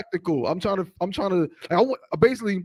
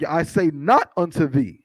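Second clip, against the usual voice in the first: slow, measured reading aloud; scripture reading; 4 dB louder, slower than usual. Acted. Reader giving the congregation verses from Matthew, slow and declamatory. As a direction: read it out deliberately, word by word.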